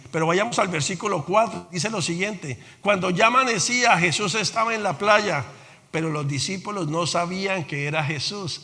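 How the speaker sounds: usual speaking voice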